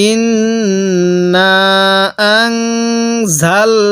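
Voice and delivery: slow chanted ইন্না with held nasal; slow recitation. Shown as a demonstration. Teacher recites ইন্না for the class to repeat. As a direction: draw it out. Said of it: "আরেকবার আমার সাথে পড়ুন".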